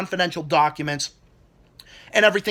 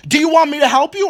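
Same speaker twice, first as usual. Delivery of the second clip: exasperated retort; 9 semitones higher, 6 dB louder, faster than usual. Retold or imitated. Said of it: "It's just like".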